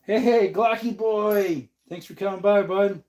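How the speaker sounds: high, loud cheerful hello; excited greeting. Real, not acted. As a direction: greet a buddy with a big cheer. Greeting a viewer.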